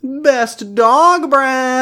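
hammed-up high announcer voice; squeaky mock award announcer. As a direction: stretch the award out in a silly high voice.

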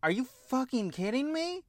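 high disbelieving outburst; can't believe what you just saw; incredulous. Retold or imitated. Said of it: "And I went".

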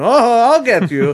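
high, slow, loud cartoon villain; mustache twirling villain. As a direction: gloat like a cartoon bad guy. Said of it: "twirling his mustache and".

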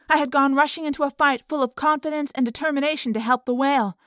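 usual speaking voice

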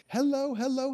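a sudden high, bright ad-read greeting; Jeff's streamer ad voice. Retold or imitated. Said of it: "Jeff's commercial reads are so funny".

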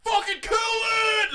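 high, slow hammed-up yell; mock shout. Townsfolk seeing the lizard man, mock panicked shout.

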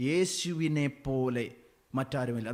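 ordinary talk, nothing marked

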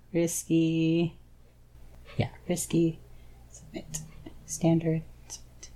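very soft, near-whispered muttering; murmuring to oneself. Real, not acted. Player entering a risky roll, murmuring to themself. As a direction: read it off under your breath.

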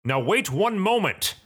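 high-pitched protest from a priest; indignant objection. Acted. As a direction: cut in, affronted.

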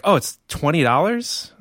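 usual speaking voice

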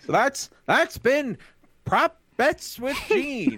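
smooth sly gambler drawl, high and slow. Acted. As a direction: announce it like a riverboat gambler. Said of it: "That's my gambler voice".

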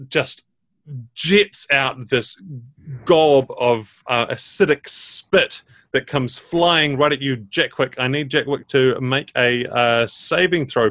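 usual speaking voice

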